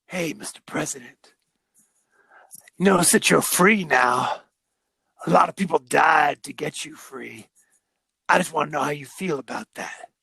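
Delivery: a lightly voiced, slightly low Snake Plissken impression; half-hearted imitation of a tough guy's cool question; Kurt Russell as Snake. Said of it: "Kurt Russell goes like".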